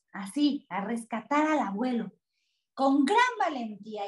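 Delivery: usual speaking voice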